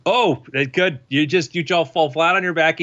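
usual speaking voice

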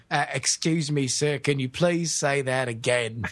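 low, slow British bobby voice; patient police officer. Acted. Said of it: "very patient police officer".

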